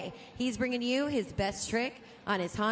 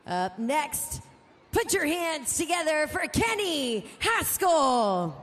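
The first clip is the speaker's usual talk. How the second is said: drawn-out, louder, higher rider intro; announcer intro call; 4 semitones higher, 4 dB louder, slower than usual. Real, not acted. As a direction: stretch the name for the crowd.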